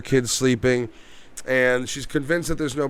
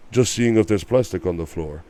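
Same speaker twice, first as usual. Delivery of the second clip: low, dry deadpan delivery; deadpan movie line; 8 semitones lower than usual. Retold or imitated. A cop deadpanning a joke about plastic on the floor, low and dry. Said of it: "And he's like".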